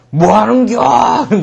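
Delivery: high, loud giant bellow; dinosaur-like roar. Acted. Giant yelling at children in his garden, roaring. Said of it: "공룡 소리가 나겠죠".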